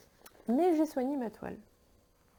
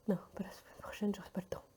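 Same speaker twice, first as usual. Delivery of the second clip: soft whispered self-correction; 8 dB quieter, faster than usual. Real, not acted. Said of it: "n'importe quoi".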